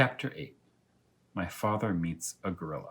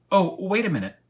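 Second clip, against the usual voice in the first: urgent; 10 semitones higher, 7 dB louder than usual. Acted. Elmer stalls the hungry lion.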